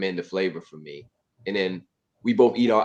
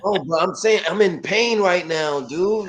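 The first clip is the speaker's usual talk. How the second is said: much higher, louder whining complaint; exaggerated, pitiful moaning; hammed-up stomach-ache whine; 8 semitones higher, 5 dB louder than usual. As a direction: overplay the pain to blame your friend. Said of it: "just play along with me with this".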